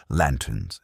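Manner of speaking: plain reading voice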